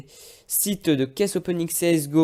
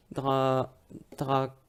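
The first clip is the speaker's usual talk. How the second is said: ordinary delivery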